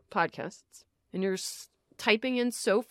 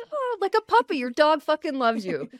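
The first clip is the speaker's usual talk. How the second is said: excited puppy comparison; 5 semitones higher, 5 dB louder than usual. Real, not acted. Comparing to doting dog.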